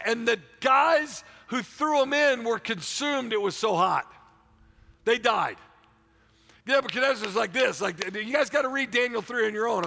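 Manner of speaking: loud, high, fervent preaching; a preacher roaring the story of the furnace; full-force preaching. Acted. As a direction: preach it hard to the crowd.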